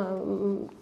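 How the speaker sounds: ordinary delivery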